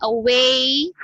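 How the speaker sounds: "away" with a long, projected "way"; projected pronunciation. Shown as a demonstration. Teacher shows mouth projection to students, drawn-out pronunciation. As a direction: project "away", stretching the final "ay". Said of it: "with the projection of your mouth".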